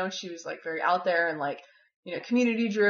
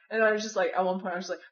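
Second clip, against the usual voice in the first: usual speaking voice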